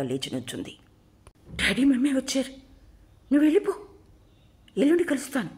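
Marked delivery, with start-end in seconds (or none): 1.5-5.5 put-on high, hurried panicky girl's voice; flustered. A narrator's reading. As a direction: shoo him off in a fluster, higher. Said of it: "అన్నది శబరి గాభరాగా". (గాభరాగా ఎత్తైన స్వరంతో)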